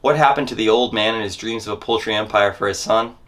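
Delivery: ordinary talk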